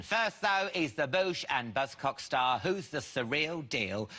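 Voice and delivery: talk-show host's big build-up; show announcer introduction. Acted. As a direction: announce the guest with a soaring finish. Host announces the guest.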